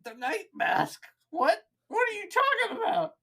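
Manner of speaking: high, baffled orc voice; squeaky puzzled orc; confused orc. Acted. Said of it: "the orc goes".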